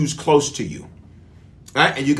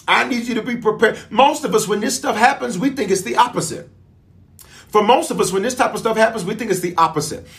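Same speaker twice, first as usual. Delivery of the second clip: preaching; 5 semitones higher than usual. Acted. Preaching to the congregation.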